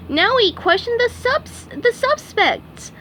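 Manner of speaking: very high, eager cartoon sponge voice; high squeaky sponge voice; SpongeBob voice. A narrator's reading. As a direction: chirp it like a keen little fry cook. SpongeBob speaks.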